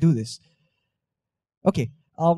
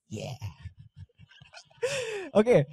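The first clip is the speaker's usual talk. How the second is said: ordinary delivery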